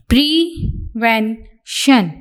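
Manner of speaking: Prevention drawn out slowly, every syllable stretched; slow dictation take. Shown as a demonstration. Teacher dictating prevention to the class, slow and stretched. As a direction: stretch prevention out so students can write it. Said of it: "dictation word said for writing".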